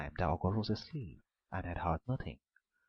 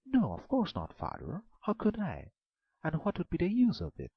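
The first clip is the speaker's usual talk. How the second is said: a much higher maiden's voice; a maiden denies any treachery to the ogre, high; protesting maiden; 10 semitones higher than usual. A narrator's reading. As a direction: play innocent, quickly.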